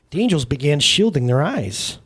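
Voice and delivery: ordinary delivery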